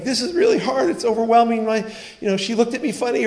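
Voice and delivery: high-pitched mock child's complaint; upset little kid. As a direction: whine like an overwhelmed child. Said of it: "they're crying".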